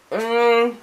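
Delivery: munching hum; chewing while talking. Real, not acted. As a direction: mouth full, humming. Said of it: "I'm chewing on a carrot".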